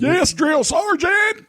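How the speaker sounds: high, slow, barked yes sir reply; barked military recruit reply. Pretend. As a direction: shout it like a boot camp recruit. Mock recruit answering drill sergeant.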